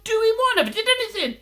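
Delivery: very high, squeaky protest; indignant innocence. Retold or imitated. Man accused by police officer, high-pitched denial.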